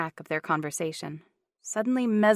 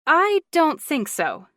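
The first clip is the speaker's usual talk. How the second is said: high, loud, slow no; firm refusal; 9 semitones higher, 9 dB louder, slower than usual. A narrator's reading. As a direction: shut it down firmly. Riley refuses.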